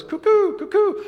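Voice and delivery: imitating a cuckoo bird, high and bright; cuckoo call. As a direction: chirp it like a cuckoo clock. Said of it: "the sound that bird goes".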